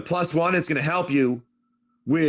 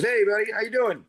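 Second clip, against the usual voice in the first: higher-pitched, casual in-character hello; lightly voiced greeter; 6 semitones higher than usual. Guy greeting the ghoulish doctor, lightly voiced higher hello. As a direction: lightly play a friendly passerby, pitched up. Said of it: "the guy goes".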